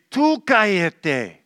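"Tú" hit high and loud, said slowly; single out one child with a slow pointed "Tú cállate"; "Tú cállate" with a punched "Tú", aimed at one child; stress on Tú. Shown as a demonstration. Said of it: "to that one child who's making all the noise".

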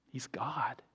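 hushed, reverent whisper; whispered awe. Real, not acted. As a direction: whisper it, breath only. Awed remark about meeting God.

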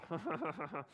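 short uncomfortable laugh at a crude joke; a nervous chuckle; awkward laugh. Retold or imitated. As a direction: laugh it off, uneasy. Reacting to crude jokes.

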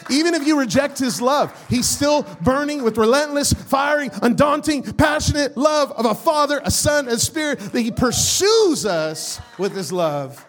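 impassioned, driving preacher delivery; rousing sermon cry; full-force preaching. Real, not acted. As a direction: preach it with fire, punching the key words.

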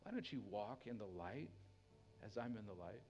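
soft, gentle pleading voice of God; tender God. Acted. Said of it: "he tenderly and gently".

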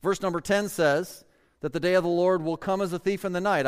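normal talking voice

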